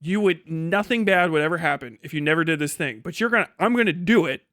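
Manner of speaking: ordinary talk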